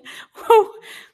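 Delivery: loud high-pitched burst of laughter; real shocked cackle; incredulous laugh. Real, not acted. Stunned laughter at her mistake.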